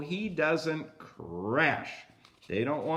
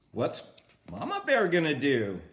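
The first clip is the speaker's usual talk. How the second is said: usual speaking voice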